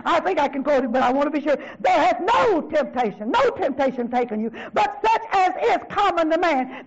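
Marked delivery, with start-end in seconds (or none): 1.8-6.8 emphatic scripture reading. Retold or imitated. Reading the verse back to the crying wife, emphatic declaiming. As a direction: declaim the verse, punching key words. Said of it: "Let me read it to you".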